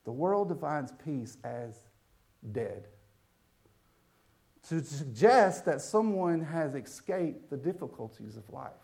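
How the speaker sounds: usual speaking voice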